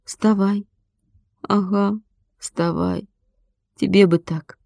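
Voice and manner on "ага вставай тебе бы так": bitter echo. A narrator's reading. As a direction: echo the order back weakly and resentfully. Hurt boy echoes her bitterly. (тихо, с обидой огрызнуться)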